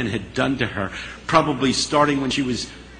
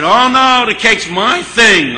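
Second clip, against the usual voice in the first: much higher and louder, slow on the cake line; eager claim; 9 semitones higher, 9 dB louder, slower than usual. Retold or imitated. Harry eagerly claiming the cake for the party. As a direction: jump in and insist it's yours.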